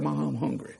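put-on soft, quick quote of a child; hushed retelling. Preacher retells the boy's words to mother, hushed quote. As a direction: say it small and quiet, without drawing it out.

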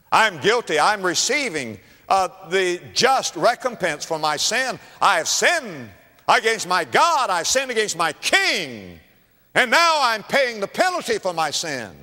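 high, loud admission of guilt; acted penitent declaring his sin; impassioned confession. Prisoner confesses guilt to the king.

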